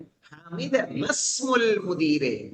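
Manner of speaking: usual speaking voice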